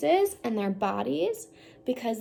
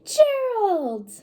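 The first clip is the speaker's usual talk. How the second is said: higher, louder friendly call; cheerful Piggy; 8 semitones higher, 8 dB louder than usual. Acted. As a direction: call out to your friend brightly. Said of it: "how to change my voice".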